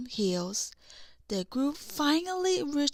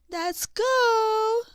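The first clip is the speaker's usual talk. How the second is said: much higher, louder gleeful cry; eager shout; 10 semitones higher, 7 dB louder than usual. A narrator's reading. Olaf eager to slide down the cliff, excited shout. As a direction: burst out with delight.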